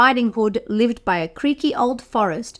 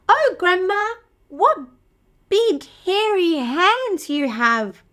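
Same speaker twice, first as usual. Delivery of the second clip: put-on higher, wondering girl voice; little girl; 7 semitones higher than usual. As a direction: sound amazed and innocent.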